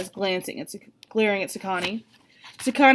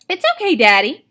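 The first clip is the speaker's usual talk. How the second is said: put-on child talking while chewing; mouth full; 7 semitones higher, 8 dB louder than usual. A narrator's reading. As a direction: speak through a bite of sandwich. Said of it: "says with a mouthful of sandwich".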